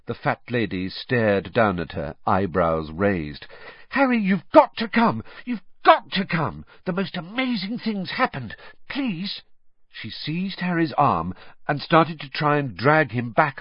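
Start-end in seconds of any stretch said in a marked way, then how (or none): 3.9-9.4 breathless urgency. Acted. Hermione bursts in urgently.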